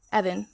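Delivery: Ivan said as EV-an; trying a pronunciation. Shown as a demonstration. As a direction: say Ivan with a short "eh" start, like Evan. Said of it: "I don't know how to pronounce it".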